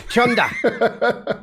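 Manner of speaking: chunda slow and drawn out with a laugh; Aussie chunda. Shown as a demonstration. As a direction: stretch chunda out, r dropped, laughing. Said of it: "it's chunda".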